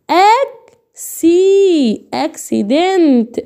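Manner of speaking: "اك سي" long, high, split, then "اكسيدنت" joined; slow sounding-out. Shown as a demonstration. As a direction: sound out "اك سي" slowly before the whole word. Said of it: "اقرا بقى يا معلم".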